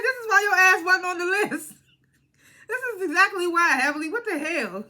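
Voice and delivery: high, loud exasperated outburst; loud exclamation. Retold or imitated. Viewer calling out Heavenly over the list, loud exasperation.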